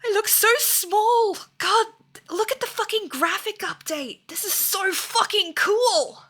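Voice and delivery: high and loud, slow with amazement; excited. Real, not acted. Streamer marvelling at the graphic update, high and excited. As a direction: gush over the new graphics.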